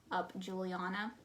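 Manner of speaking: ordinary talk, nothing marked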